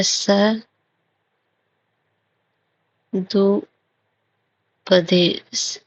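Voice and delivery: सदुपदेश stretched very slowly, each sound held long; drawn-out spelling. Shown as a demonstration. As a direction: drag out सदुपदेश while writing it on the board. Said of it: "सदुपदेश लिखा जाता है". (सदुपदेश को बहुत धीरे खींचकर बोलना)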